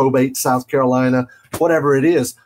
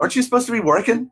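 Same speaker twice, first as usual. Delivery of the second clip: teasing mother; 10 semitones higher, much faster than usual. Acted. Teasing his mother watching.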